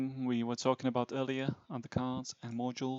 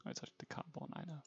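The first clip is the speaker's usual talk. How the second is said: hushed correction under breath; whispered aside; 3 semitones higher, 15 dB quieter, much faster than usual. Real, not acted. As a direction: whisper it to yourself.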